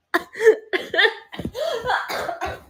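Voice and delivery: high giggling burst; laughing fit. Real, not acted. Streamer laughs at her own joke, giggling high. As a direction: break into helpless laughter.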